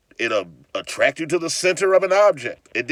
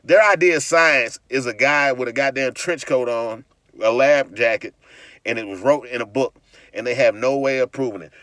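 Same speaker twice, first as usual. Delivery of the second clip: usual speaking voice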